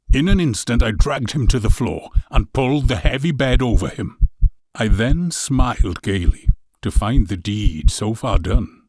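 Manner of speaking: plain reading voice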